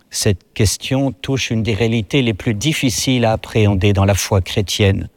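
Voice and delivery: ordinary delivery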